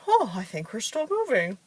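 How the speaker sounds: high, swooping comic realization; mock alarm. Couple in a car not put in park, mock alarm. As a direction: act out a sudden "oops", pitch leaping.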